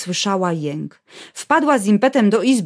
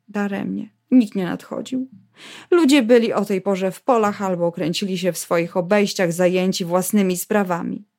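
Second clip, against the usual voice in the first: ordinary delivery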